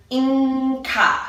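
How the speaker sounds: INCA slowly, with a long NG before the CA; drawn-out word. Shown as a demonstration. Teacher sharing a favorite practice word, INCA drawn out. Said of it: "I draw it out".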